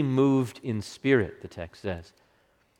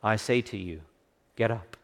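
gentle; 3 semitones lower, 6 dB quieter than usual. Acted. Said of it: "in a voice that must have been ever so gentle".

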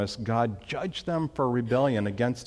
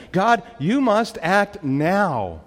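emphatic demand, higher and slow; 5 semitones higher, 3 dB louder, much slower than usual. Acted. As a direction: press each word as an urgent plea to God. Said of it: "He's emphatic".

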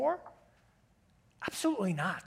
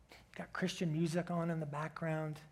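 hushed, breathy whisper; barely voiced murmur; whispered aside; 4 semitones lower, 8 dB quieter than usual. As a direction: drop to a whisper as if the music plays softly. Background music set very quietly.

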